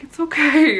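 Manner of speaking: high-pitched mock reassurance; mimicked reassurance. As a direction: imitate amused men calling out comfort. Mimicking laughing men reassuring her.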